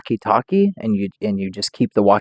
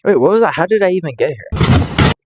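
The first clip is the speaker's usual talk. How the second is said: louder and higher, caught off guard; real surprise; startled; 6 semitones higher, 3 dB louder than usual. Real, not acted. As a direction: snap to attention at the noise. Startled by a sudden clip.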